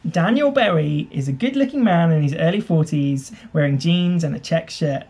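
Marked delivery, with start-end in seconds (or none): none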